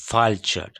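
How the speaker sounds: ভালচার said slowly, each sound clear, v-sound at the start; slow careful demo. Shown as a demonstration. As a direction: spell out ভালচার slowly like a teacher. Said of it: "উচ্চারণ হয় ভ".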